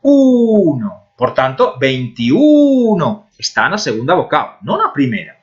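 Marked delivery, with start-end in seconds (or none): 0.1-1.1 uno with a long, high "u"; stress on U. Shown as a demonstration. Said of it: "apresenta a sílaba tónica en uno". 1.8-3.3 veintiuno with a drawn-out, high "u"; veintiuno, leaning long on "uno"; stress on U. Shown as a demonstration. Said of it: "apresenta a sílaba tónica en uno".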